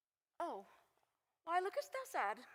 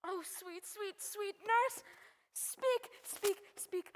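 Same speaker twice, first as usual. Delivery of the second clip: rising, urgent pleading; impatient begging; 4 semitones higher, much slower than usual. Acted. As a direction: press her hard for news. Juliet demands the news impatiently.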